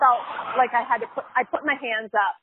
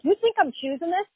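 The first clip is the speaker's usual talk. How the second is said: higher, mildly angry challenge; mad retort; 6 semitones higher than usual. Retold or imitated. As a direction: retell an offended comeback. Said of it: "I was so mad".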